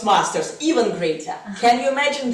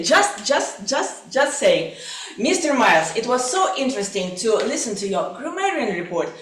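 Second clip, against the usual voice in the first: ordinary delivery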